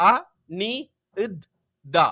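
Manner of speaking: அ, நி, இத், தா with a pause after each; syllable by syllable. Shown as a demonstration. Teacher showing pupils how it is pronounced, அ நி இத் தா in separate beats. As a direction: sound out Anitha piece by piece. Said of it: "அப்ப உச்சரிக்கிறது".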